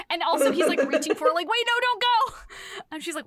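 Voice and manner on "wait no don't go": high, loud mock plea; hammed-up desperate plea; pleading cry. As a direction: call after her, begging. Werewolf begging her to stay.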